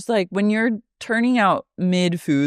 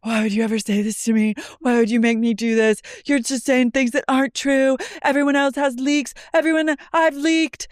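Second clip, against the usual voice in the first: rising higher, into a shouted protest; mock Lisa pleading; 7 semitones higher than usual. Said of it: "And she goes".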